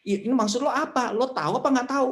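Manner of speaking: high, baffled demand; exasperated question. Acted. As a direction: press them, confused and impatient.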